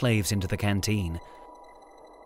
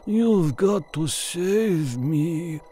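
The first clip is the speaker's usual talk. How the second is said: slow, weak, dragging plea; delirious pleading; 6 semitones higher, much slower than usual. A narrator's reading. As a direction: beg through the pain. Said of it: "he was mumbling, delirious".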